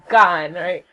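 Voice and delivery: ordinary talk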